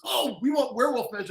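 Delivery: high-pitched excited quote; eager rep. Retold or imitated. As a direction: gush like a keen publishing rep. Rep's eager reply acted out.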